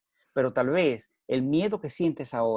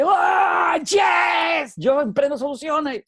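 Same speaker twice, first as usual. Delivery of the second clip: a much higher, louder, slow roaring yell; Mel Gibson battle cry; 14 semitones higher, 7 dB louder, much slower than usual. Retold or imitated. Speaker reenacting his cry in the car, battle yell. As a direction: roar like a warrior charging. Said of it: "como si yo fuera Mel Gibson".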